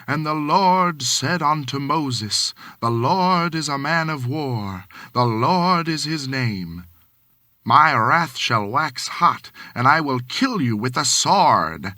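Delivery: loud, slow booming sermon voice; bellowing preacher. A narrator's reading. Minister bellowing at the congregation, booming and slow. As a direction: thunder scripture from the pulpit. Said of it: "when he bellowed".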